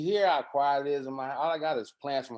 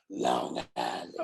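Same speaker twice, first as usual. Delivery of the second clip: very low, slow and heavy, like a bass; mock deep voice; 12 semitones lower, slower than usual. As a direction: imitate her voice dropping deep into a growl. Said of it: "the words came out".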